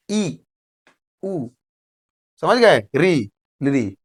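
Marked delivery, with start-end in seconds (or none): 0.1-1.5 ई and उ each said briefly; short vowels. Shown as a demonstration. Teacher demonstrating short vowels, brief ई and उ. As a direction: keep ई and उ short, one quick beat each. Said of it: "बहुत कम समय लगता है".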